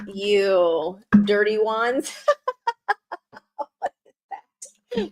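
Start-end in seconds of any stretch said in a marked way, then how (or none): 2.2-5.1 laughing. Real, not acted. Laughing at chat comments.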